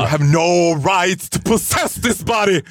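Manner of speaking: retold exorcism on the sofa, shouted; mock exorcist shout. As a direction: cast the demon out, booming. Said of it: "blir så här livrädd själv och bara".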